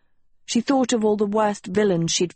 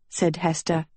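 ordinary delivery, nothing marked

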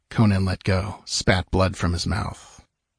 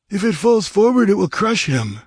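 high, louder put-on voice; objecting nomad; 12 semitones higher, 4 dB louder than usual. A narrator's reading. Nomad warning his chief about felling the cross, objecting. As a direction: raise the objection in high pitch. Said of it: "objected Jabal".

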